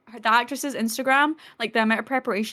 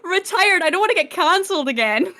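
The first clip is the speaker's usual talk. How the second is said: high, loud mock protest, laughing; exasperated correction; 8 semitones higher, 4 dB louder than usual. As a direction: act out an emphatic correction, drawing out the first words. Acted exasperated correction from film.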